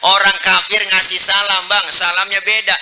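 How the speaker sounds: ordinary delivery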